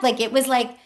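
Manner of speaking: ordinary delivery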